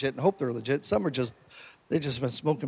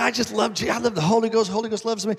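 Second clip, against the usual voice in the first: flamboyant friend; 6 semitones higher than usual. Retold or imitated. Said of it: "very flamboyant personalities".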